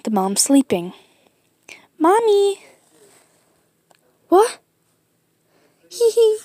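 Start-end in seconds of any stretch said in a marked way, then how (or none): 2.0-2.6 high, loud little call; squeaky pup voice, raised; pup calling mom. Acted. Pup calls sleeping mom. 4.3-4.6 high, loud what; acted high-pitched start; startled reply. Mom woken by pup. 5.9-6.4 high, loud hee-hee; squeaky acted titter; pup giggle.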